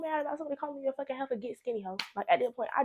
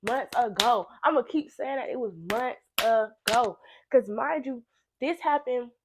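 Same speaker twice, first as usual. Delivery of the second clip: ordinary talk, nothing marked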